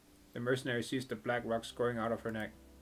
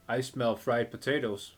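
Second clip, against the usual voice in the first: usual speaking voice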